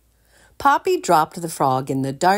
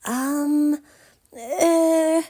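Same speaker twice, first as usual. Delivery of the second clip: nervous hesitation; 9 semitones higher than usual. A narrator's reading. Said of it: "the maid began nervously".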